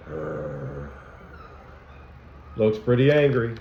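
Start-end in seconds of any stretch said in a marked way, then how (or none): 0.1-0.9 a deep, soft cartoon growl; low growl. Teacher voicing Anger for the class, low mock growl. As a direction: growl like a grumpy cartoon character.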